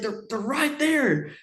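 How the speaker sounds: slow, very high-pitched insisting; imitated exasperated insistence. Dad insisting to the doubtful hunter, imitated exasperation. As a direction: mimic stubborn insistence, drawn out and high.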